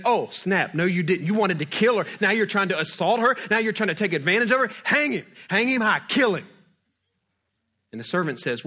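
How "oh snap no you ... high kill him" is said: higher, louder outraged accusation rising to a yelled sentence; furious king. Retold or imitated. King catching Haman on Esther, ordering him hanged in rage. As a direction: bark the death order at the end. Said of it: "the king comes back ... he's like".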